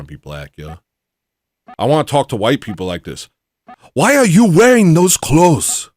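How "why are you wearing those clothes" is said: loud, high mock African accent. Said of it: "I would use an African accent".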